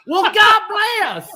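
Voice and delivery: drawn-out, very high and loud God bless. Retold or imitated. Brother hammering the scam caller with God bless. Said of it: "he's, like, getting louder".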